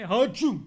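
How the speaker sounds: big, drawn-out mock sneeze; hammed-up sneeze; loud fake sneeze. Character sneezing.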